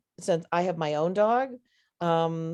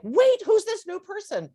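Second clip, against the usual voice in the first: much higher and louder, alarmed voice for the dog; startled dog; 4 dB louder than usual. Retold or imitated. Owner voicing her fearful dog when the brother stands up, startled and high. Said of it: "the dog was like".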